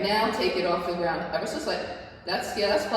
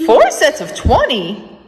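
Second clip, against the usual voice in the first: disbelieving; 6 semitones higher, 12 dB louder, slower than usual. Real, not acted. Disbelief at workout count.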